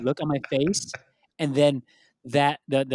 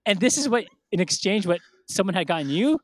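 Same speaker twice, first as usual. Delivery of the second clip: higher, louder mock outrage; indignant mom; 6 semitones higher, 4 dB louder than usual. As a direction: imitate a mother demanding an explanation. Mom indignant about the gift.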